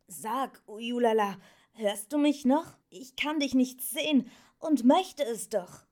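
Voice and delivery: put-on high, pleading young voice; young Atreyu. A narrator's reading. Atreyu asks the unseen Ujulala to show herself, pleading. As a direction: call out searchingly to someone unseen.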